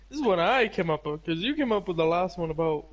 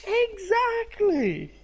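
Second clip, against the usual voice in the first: excited; 11 semitones higher, much slower than usual. Real, not acted. Enthusiastic agreement.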